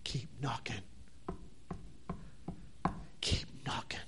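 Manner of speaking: whispered repeat, barely voiced. Real, not acted. Preacher closing the sermon, whispering. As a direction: breathe it out as a whisper. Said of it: "the conclusion".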